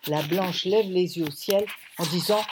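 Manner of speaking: usual speaking voice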